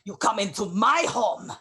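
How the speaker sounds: loud, high challenge to an intruder; outraged confrontation. Acted.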